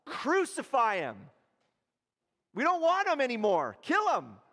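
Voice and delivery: high, loud hostile yell; angry crowd yell. Acted. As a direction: turn on him like a mob. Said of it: "these same crowds are gonna be yelling what?".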